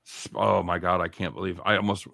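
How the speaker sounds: ordinary talk, nothing marked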